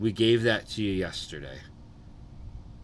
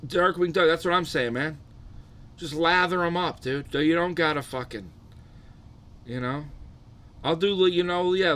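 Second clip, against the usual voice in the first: ordinary talk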